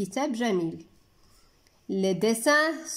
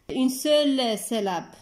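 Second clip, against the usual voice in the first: ordinary talk, nothing marked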